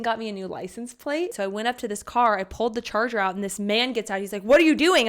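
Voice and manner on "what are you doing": loud, raised-pitch challenge; retold alarmed demand. Car owner to stranger pulling his charger, imitated loud alarm. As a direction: act out a startled man confronting someone. Retold startled man confronting her.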